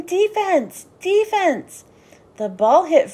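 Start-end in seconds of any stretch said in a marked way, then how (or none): 0.0-1.8 high coach's yell. A narrator's reading. Dad shouts at the team from the sideline, high. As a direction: call it across the field. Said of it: "Froggy's dad was yelling".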